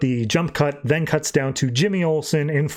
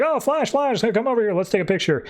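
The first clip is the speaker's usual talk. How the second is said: high, eager fan squeal; excited fans; 8 semitones higher than usual. Retold or imitated. Fans mobbing Flash at the bar call out in high excitement. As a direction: gush like starstruck fans calling him over.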